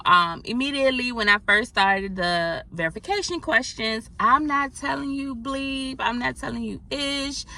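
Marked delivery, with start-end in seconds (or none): none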